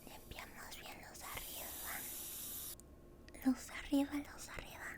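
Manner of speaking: soft, breathy close-mic whisper throughout; tender roleplay dentist whispering to a patient; ASMR whisper. Acted. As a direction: whisper gently like a dentist soothing a nervous patient.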